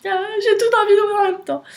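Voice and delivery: high and fast, bubbling over; over-excited. Real, not acted. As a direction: rush it, too excited to wait. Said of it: "Je vais me calmer".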